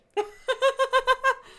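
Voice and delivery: high, loud laughter. Real, not acted. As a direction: laugh off your failed accent. Laughing after accent attempt.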